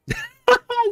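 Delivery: squeaky high burst of laughter; high giggle. Real, not acted. Streamer laughs at the angry enemy, high giggle.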